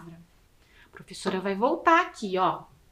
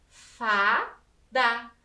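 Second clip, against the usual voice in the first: "Fá, da" split in two beats; two distinct syllables, "Fá" then "da"; sounded-out syllables. Shown as a demonstration. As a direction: say "Fá" and "da" separately, as in a spelling lesson. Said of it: "Vamos escrever fada".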